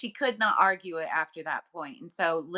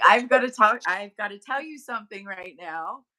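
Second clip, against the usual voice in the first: urgent excitement; 3 semitones higher, 5 dB louder than usual. Retold or imitated. Telling the daughter she must hear this, urgently excited. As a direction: blurt it out, can't hold it in. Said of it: "I was like".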